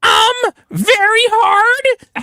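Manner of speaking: high, slow, whimpering read; mock whiny falsetto. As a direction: ham up the texts in a high wail. Mock reading of texts.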